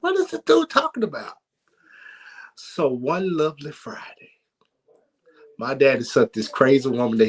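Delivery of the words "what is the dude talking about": high mock disbelief. Boy baffled by his dad's circus plan, high mock disbelief. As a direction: pitch way up, puzzled.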